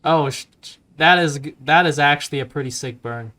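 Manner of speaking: ordinary talk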